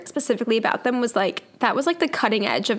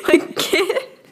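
high squealing laughter through the word "Kit"; laughing hard; 8 semitones higher, much slower than usual. Real, not acted. Telling a friend about the podcast, helpless high-pitched laughter. Cracking up over the dolls.

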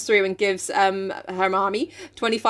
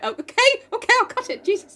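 mock flustered barber; 14 semitones higher than usual.

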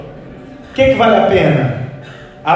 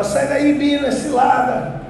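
TV catchphrase warning; 4 semitones higher, 3 dB quieter, slower than usual. Retold or imitated. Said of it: "ele falava toda vez que o Bino ia entrar numa cilada".

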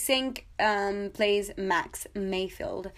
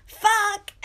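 laughing exclaim; 11 semitones higher, 7 dB louder than usual. Real, not acted. Laughing while saying a swear.

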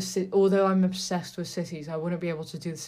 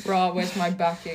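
mock posh Tory. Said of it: "I sound, like, Tory as fuck".

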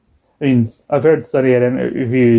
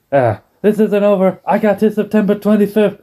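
defiant Eddie; 8 semitones higher than usual. Retold or imitated. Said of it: "door slammed in his face".

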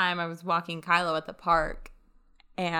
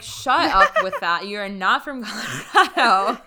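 mock outrage; 7 dB louder than usual. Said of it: "I'm like".

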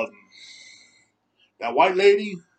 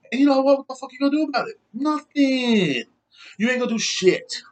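pitched way up, challenging and scornful; defiant taunt; 9 semitones higher than usual. Real, not acted. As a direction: dare them to do something about it. Defiant taunt at online critics.

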